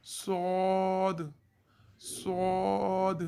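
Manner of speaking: सौद with a heavy, full s, stretched very slowly; Arabic sad. Shown as a demonstration. Teacher demonstrating sad, heavy and drawn out. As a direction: draw सौद out heavy and slow. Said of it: "नाज़रीन, सौद".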